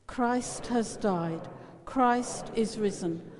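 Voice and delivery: ordinary talk, nothing marked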